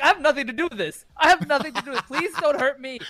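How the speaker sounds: high-pitched mock panic; frightened pleading. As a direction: beg for mercy, hammed-up and high. Pleading with Roman as frightened viewer.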